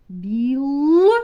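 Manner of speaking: "Білка" with a long, loud held Л; виділений звук л. Shown as a demonstration. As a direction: hold the Л in "Білка", then finish. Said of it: "Наступний звук". (протяжне, гучне Л у слові "Білка")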